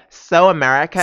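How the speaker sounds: exaggerated American accent, mocking; put-on American twang in imitation; Sybil's mocking American accent. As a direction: loudly mimic someone's American accent. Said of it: "She even imitates her".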